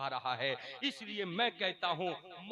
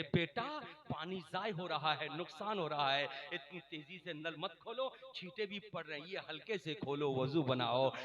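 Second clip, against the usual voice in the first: gentle correction; 4 semitones lower than usual. Retold or imitated. Elder advises a boy about the running tap, gentle correction. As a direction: coax kindly rather than scold. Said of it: "बजाय ये कि उसको डांटते".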